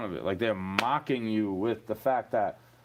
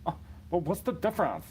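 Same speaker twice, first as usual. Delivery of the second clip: higher whiny mock question; mocking haters; 6 semitones higher than usual. Mimicking haters asking what the difference is, high and whiny. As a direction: whine it like a know-it-all troll. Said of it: "haters will be like".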